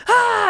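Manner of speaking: high, loud mock scream; imitated scream. Imitating the roommate screaming in her face, high scream. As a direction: manic yell, acted out. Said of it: "he screams as loud as he can".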